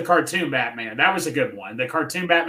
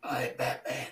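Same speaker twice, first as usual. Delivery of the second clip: low, soft Batman voice; quiet deep Batman impression; 5 semitones lower, 14 dB quieter, slower than usual.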